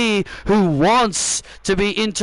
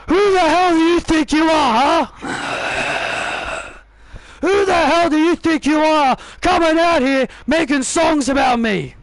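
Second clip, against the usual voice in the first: angry shout; 5 semitones higher than usual. Acted. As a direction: scream at him for mocking you. Rival storms out angry.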